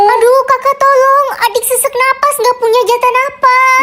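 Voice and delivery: panicked plea. Acted. Younger sibling begging older one for breath, in panic. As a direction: plead as if running out of air.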